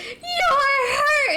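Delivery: put-on high, loud crying child's cry; small kids crying at the babysitter's injury. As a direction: wail it like a scared little kid. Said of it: "then they start crying".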